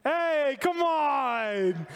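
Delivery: mock-pleading with the congregation; teasing call-out. Real, not acted. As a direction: egg the crowd on.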